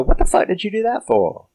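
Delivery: high, indignant voice of a hypocrite; shrill mock outrage at the robot he ordered; mock outraged Josh. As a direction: play the whiny outraged owner. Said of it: "got the nerve to sit there and go".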